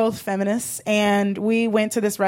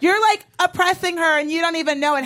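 usual speaking voice

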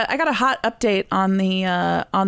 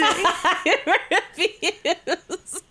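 giggling, higher and very slow; laughing refusal; 7 semitones higher, much slower than usual. Real, not acted. Refusing her co-host's request to redo the accent, laughing.

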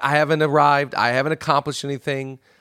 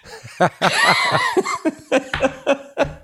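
burst of laughter. Real, not acted. Big laugh.

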